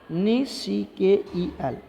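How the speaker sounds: slow, spaced-out letters for the class; spelling out. Shown as a demonstration. Teacher spelling nickel for students, spelled out slowly. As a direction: spell it slowly, one piece at a time.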